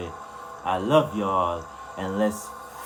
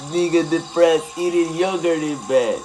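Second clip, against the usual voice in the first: loud joke; 9 semitones higher, 12 dB louder than usual. Real, not acted. Joking punchline to chat.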